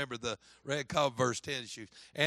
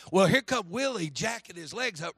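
usual speaking voice

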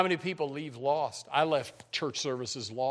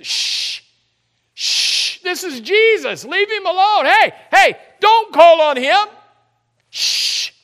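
loud, high shushing rebuke; the crowd hushes the beggar, loud and sharp; rebuking crowd; 17 dB louder, slower than usual. Acted. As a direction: shush and scold him like an angry crowd.

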